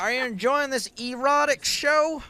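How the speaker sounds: high, loud hammed-up Southern drawl; Southern accent. Commentator doing a requested Southern accent for the guest, hammed-up Southern. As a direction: camp it up in a mock Southern accent. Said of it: "That's one version of a Southern".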